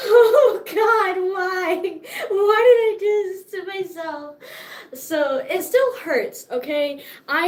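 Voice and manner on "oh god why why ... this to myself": high, loud, half-laughing wail; mock anguish. Retelling squatting with sore legs, a mock anguished high wail. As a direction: ham up the pain of squatting down. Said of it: "it was like".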